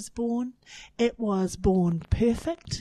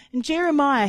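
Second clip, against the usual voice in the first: normal talking voice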